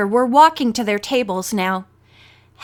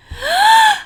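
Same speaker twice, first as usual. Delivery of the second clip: sudden high, ear-splitting sneeze; loud sneeze; 5 dB louder than usual. A narrator's reading. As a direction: let out a big explosive acted sneeze. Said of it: "ear-splitting sneeze".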